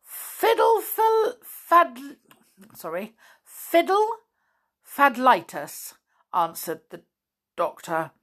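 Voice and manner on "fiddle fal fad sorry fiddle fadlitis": fumbling a word. Acted. Doctor fumbling a fake disease name.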